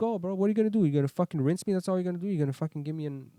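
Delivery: loud, mock-aggressive taunting, pitched high; retold fight challenge. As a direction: throw the challenge in his face, half shouting. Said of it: "This guy is like".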